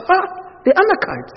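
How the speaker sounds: high, mocking disbelief on "The Anakites?"; incredulous wife. Wife scoffing at her husband's news, high and incredulous. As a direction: scoff at the very idea. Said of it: "And the wife".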